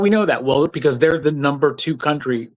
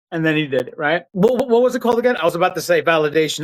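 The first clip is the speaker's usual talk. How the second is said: ordinary delivery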